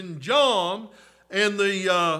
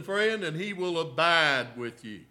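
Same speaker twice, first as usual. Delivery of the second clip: ordinary delivery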